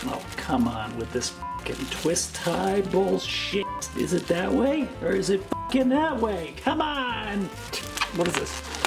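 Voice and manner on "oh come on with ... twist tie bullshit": normal talking voice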